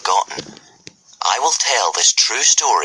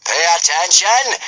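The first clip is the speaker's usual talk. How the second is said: screaming drill instructor; 10 semitones higher than usual. Acted. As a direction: scream it at the boys. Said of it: "screamed Gobber the Belch".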